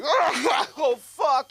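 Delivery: very high, loud group-reaction yell; exaggerated high-pitched holler; shocked outcry. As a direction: cry out in horrified surprise. Whole funeral reacts to the noise.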